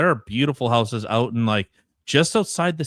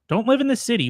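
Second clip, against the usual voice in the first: preachy; 10 semitones higher, much faster than usual. Acted. Mock advice to city dwellers.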